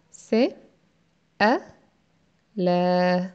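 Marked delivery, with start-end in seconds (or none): 1.4-3.3 ألا drawn out slowly as one long syllable; long syllable alaa. Shown as a demonstration. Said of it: "lues en une seule syllabe". (ألا prononcé lentement en syllabe longue)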